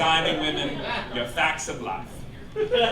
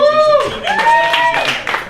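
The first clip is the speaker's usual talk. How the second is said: much higher and louder squeal; excited squeal; 12 dB louder than usual. Acted. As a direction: shriek the plug like a giddy fan. Squeals the promo plug.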